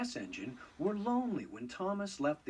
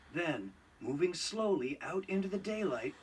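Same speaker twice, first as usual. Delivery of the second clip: ordinary delivery, nothing marked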